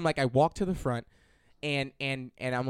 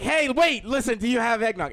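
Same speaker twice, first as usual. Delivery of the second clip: eager calling out; 7 semitones higher, 5 dB louder than usual. Retold or imitated. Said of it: "And I'm like".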